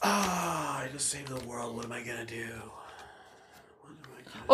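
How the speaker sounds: very soft, trailing to a breathy whisper; stunned murmur. Pretend. Hero realising he saved the world, soft murmur. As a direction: mutter it like it just sank in.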